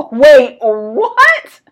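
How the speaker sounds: loud, high, drawn-out disbelief; shocked disbelief. Retold or imitated. Passenger hearing the driver's McDonald's story, loud mock-shocked disbelief. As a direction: burst out at the absurd punchline.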